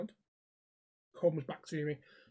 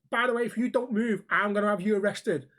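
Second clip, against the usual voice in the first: louder, higher warning; retold firm threat; 4 semitones higher, 5 dB louder than usual. Walker threatening the driver with arrest, mock-stern. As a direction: replay standing up to a pest. Retells his threat to the stranger.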